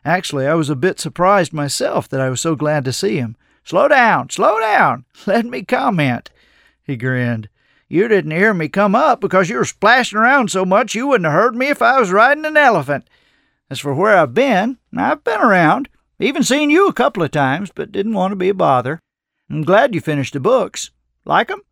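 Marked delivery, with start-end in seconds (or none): 3.6-6.3 amused, high and loud protest; grinning old man, voice lifted; chortling. A narrator's reading. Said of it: "He chortled at my enthusiasm". 7.9-21.6 put-on teasing, higher and animated old drifter; grinning, folksy banter with a young friend; lightly voiced genial old man; amused old man. A narrator's reading.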